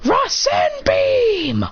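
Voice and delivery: high, very drawn-out move name; attack call. Acted. Clone firing his beam, calling out the attack. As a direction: stretch it into an anime battle cry.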